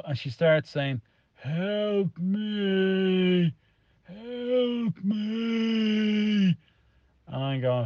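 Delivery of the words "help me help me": high, crying wail of help me; desperate wailing plea. Retold or imitated. Dad retelling the ghostly woman on the stairs, high crying wail.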